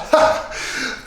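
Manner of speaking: breathy high burst of laughter, no voice; laughing hard. Acted. Friend laughing at the scared Bob, breathy laugh. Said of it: "He was laughing so hard".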